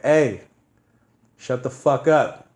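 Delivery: loud, slow, drawn-out yell; angry at the noisy drunk below. Retold or imitated. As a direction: bark it down from the balcony. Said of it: "This is how mad I was".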